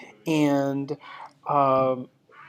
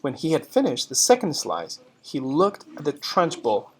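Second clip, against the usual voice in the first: story narration; 2 semitones higher, 6 dB louder than usual. Acted. Reads story narration to class.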